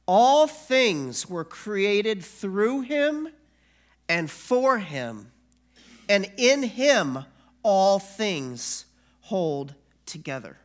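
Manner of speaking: slow, loud, weighty proclamation of each line; solemn scripture reading. Acted. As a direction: pastor proclaiming Jesus' words to the congregation, slow and loud. Reads Colossians aloud.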